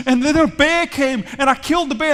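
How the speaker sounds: high, loud boasting tale; excited bravado. Acted. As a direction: shout the triumph as young David. David continues his killing tale.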